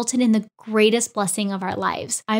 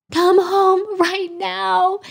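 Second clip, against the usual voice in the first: higher, slow, tearful urging; crying plea; 9 semitones higher, much slower than usual. Retold or imitated. Wife calling husband home after the test, tearful and slow. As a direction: plead through tears, slowly and high.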